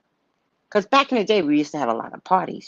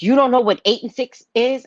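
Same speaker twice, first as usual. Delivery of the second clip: higher, louder incredulous jab; mock scolding; 9 semitones higher, 5 dB louder than usual. Spades partner mocks her counting, incredulous and louder. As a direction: needle her like an impatient card partner.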